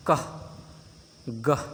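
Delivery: कह, गह with a clear h release; breathy h ending. Shown as a demonstration. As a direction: breathe out the final ह. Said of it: "उच्चारण ह की तरह करते हैं".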